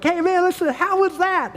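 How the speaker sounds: high, loud cheering grandpa; big excited cheer to the grandson. Retold or imitated. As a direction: cheer him on like he just did something great.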